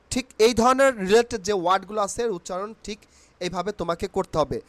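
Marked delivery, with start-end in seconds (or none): none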